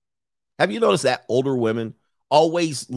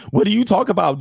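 ordinary delivery

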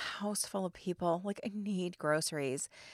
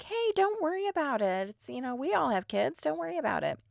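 friendly neighbours; 7 semitones higher than usual. Retold or imitated. Neighbouring table reassuring, quoted.

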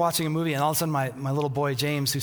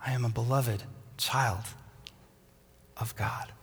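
fading to a whisper; 4 semitones lower, 5 dB quieter than usual. Acted. Answering critic voices, fading.

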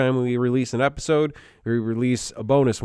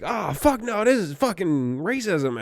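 mock indignation; 7 semitones higher, slower than usual. Imitating shoplifters protesting being kicked out.

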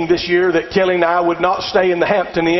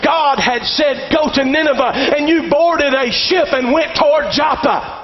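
raised-pitch sermon exhortation; forceful preaching; 4 semitones higher than usual. Real, not acted. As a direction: press the warning on the congregation. Preaches to congregation.

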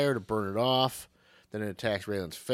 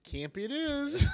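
very high, campy mock resolve; hammed-up, pitched-up surrender to camp; actor giving in. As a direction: accept the job in a silly high voice. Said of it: "He folds up, puts it in his pocket".

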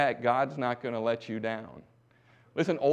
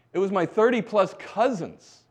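preached emphasis; 7 semitones higher, 5 dB louder than usual. Acted. Climax of sermon emphasis.

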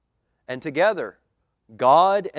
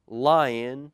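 ordinary talk

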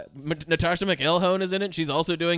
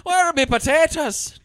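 higher, slow, louder mock brogue; cartoon Irish accent; 10 semitones higher, 6 dB louder than usual. Joking about Irish clichés, slow loud brogue. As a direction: demand it stage-Irish. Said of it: "god-awful accent".

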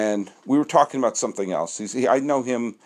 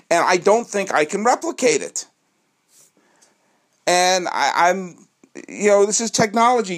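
real anger; 7 semitones higher than usual. Real, not acted. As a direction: let real frustration push the voice up. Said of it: "I'm really angry right now".

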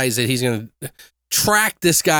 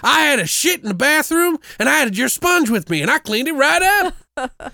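much higher, gushing trucker parody; mock devoted trucker; 11 semitones higher than usual. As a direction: swoon over the salesman in a high silly voice.

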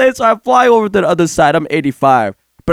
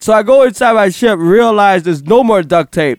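normal talking voice

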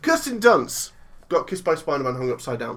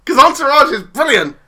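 laughing shout; 7 semitones higher, 12 dB louder than usual. Real, not acted. Laughing, loud teasing threat.

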